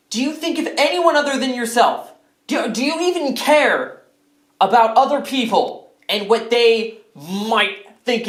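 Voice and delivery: high-pitched, exasperated rant; indignant scolding. Acted. Scolding George for being self-centered, exasperated. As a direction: tell him off, pitch climbing with indignation.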